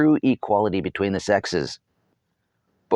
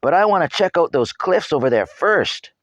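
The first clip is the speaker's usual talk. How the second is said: put-on eager boy; 11 semitones higher, 6 dB louder than usual. A narrator's reading. Eager boy voiced in story.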